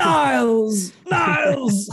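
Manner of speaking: high loud Frasier-style shout; shouting for Niles. Retold or imitated. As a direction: call out Niles with theatrical distress.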